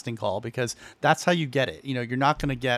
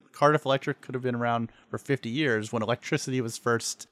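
ordinary talk, nothing marked

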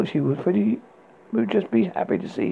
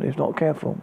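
ordinary delivery

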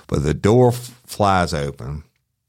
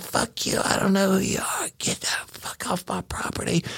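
high, whispery imitation of the old woman; old lady's retort; 6 dB quieter than usual. Cop retelling the armed woman refusing him. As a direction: hiss it like a squeaky old lady. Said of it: "She said".

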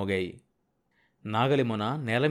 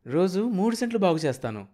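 lightly voiced higher, eager boy; a hopeful child's innocent promise, pitched up; 7 semitones higher than usual. A narrator's reading. Young son promising his father to clear land, innocently hopeful. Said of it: "అమాయకత్వంతో ఆశలు పొడచూపడం".

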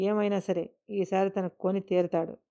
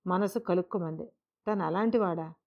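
usual speaking voice

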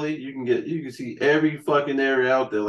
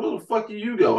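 higher mock-incredulous question; incredulous boss; 8 semitones higher than usual. Company questioning the taxi charge, higher mock disbelief. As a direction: imitate the company asking in disbelief. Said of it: "They were like".

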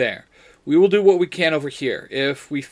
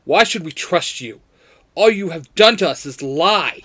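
mildly barked accusation; 4 semitones higher, 6 dB louder than usual. A narrator's reading. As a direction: push it louder and a little higher. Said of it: "Jason barks".

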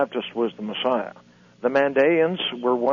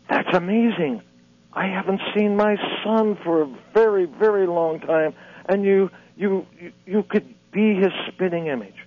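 higher, put-on old man's voice; 6 semitones higher than usual. Old man marvels to Jesus, in a higher put-on voice. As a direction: play the delighted old father, pitched up.

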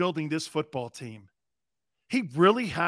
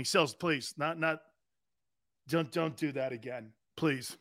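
pleading listeners; 4 semitones lower, 8 dB quieter than usual. Retold or imitated. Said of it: "people are texting me".

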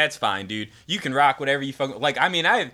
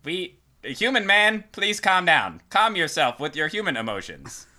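alien voice; 6 semitones higher than usual. Acted. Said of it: "you went with that bit".

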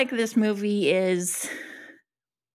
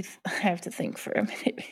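whispered to herself; breathy aside while thinking; whispering; 6 dB quieter than usual. Real, not acted. Thinking aloud, unvoiced.

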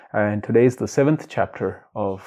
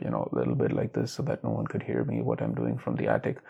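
much softer and lower, barely above a hush; hushed attic voice; 13 semitones lower, 11 dB quieter than usual. Shown as a demonstration. Podcaster showing his old attic voice, very soft and low. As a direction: drop right down so nobody in the house can hear. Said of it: "I was always talking a little softer".

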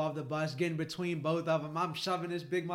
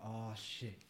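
low, soft muttered dread; hushed onlookers; 7 semitones lower, 11 dB quieter than usual. Retold or imitated. Bus passengers watching a couple argue, low and hushed. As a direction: imitate onlookers murmuring under their breath. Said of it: "everybody on the bus got silent".

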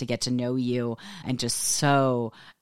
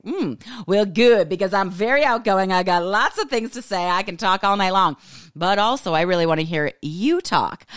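high, loud, chatty self-quote; playfully overeager, pitched well up; eager reply; 7 semitones higher, 6 dB louder than usual. Retold or imitated. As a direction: gush like an outgoing talker on a date. Acting out her eager date reply.

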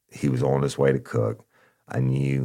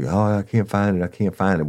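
high, whining fake search; whiny informant; 7 semitones higher than usual. Informant stalling the cop, whining high.

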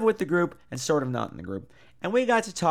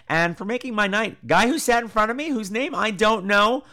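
ordinary talk, nothing marked

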